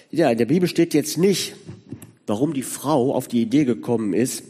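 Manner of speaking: normal talking voice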